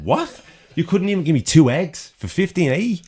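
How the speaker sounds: high, loud indignant squawk; mock outrage at the price; outraged disbelief. Outraged at airport fry price.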